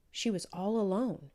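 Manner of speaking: ordinary delivery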